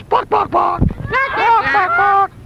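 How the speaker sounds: very high, squawky clucks; chicken clucking. Pretend. Host in chicken suit clucks.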